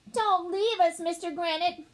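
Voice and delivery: put-on high, pleading child's cry; plaintive plea to a teacher; begging. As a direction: beg urgently, pitched well up. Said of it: "begged Alexia".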